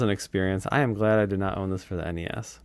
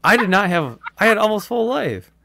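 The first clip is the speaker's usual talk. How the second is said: louder, higher indignant protest; 8 semitones higher, 5 dB louder than usual. Real, not acted. Player complains to her friend about the insta-death, louder and indignant. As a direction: protest that it isn't fair.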